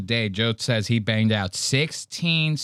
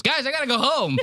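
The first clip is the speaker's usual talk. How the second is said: high, whiny mock plea; nervous nerd friend; 12 semitones higher, faster than usual. Mocking the nerd friend begging to leave, high and whiny. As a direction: whimper like the friend bailing on the trip. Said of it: "he goes".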